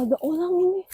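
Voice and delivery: ordinary delivery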